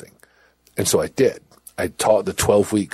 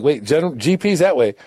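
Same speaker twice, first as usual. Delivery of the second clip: higher, rising confusion that slows at the end; disbelief; 6 semitones higher, 3 dB louder than usual. Retold or imitated. Prisoner questioning guards on the walk to the block, baffled. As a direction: sound baffled at where they're taking you. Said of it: "and I'm like".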